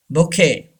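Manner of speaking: bouquet as "bo-KAY"; silent T. Shown as a demonstration. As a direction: say bouquet with a k sound and no T. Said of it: "T is silent".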